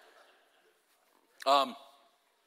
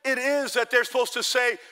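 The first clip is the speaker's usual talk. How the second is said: high, loud pulpit exclaiming; full-force preaching; 6 semitones higher, 7 dB louder than usual. Real, not acted. Preacher proclaims God's greatness to congregation, forceful and raised. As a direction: drive the point home to the whole church.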